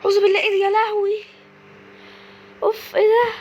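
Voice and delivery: high, loud frightened gasps of disbelief; panicking at a horror update; startled fright. Real, not acted. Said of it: "انا اتخضيت".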